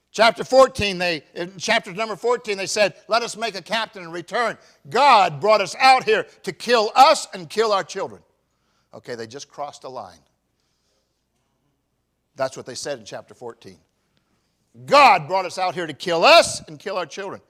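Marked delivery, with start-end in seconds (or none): 0.1-8.2 loud, high preaching call; preacher booming out the text; full-force preaching. Acted. 14.9-17.4 loud, high preaching call; preacher booming out the text; full-force preaching. Acted.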